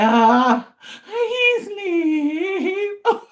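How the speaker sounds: slow, drawn-out whiny sob; whimpering cry. Retold or imitated. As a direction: whine it out like a tired, hurting kid. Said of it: "just crying like".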